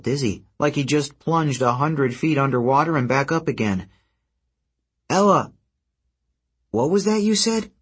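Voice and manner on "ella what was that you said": high, pressing question; urgent. Acted. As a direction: urge her to repeat it. Percy urgently calling.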